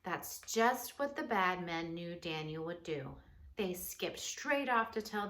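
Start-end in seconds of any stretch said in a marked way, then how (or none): none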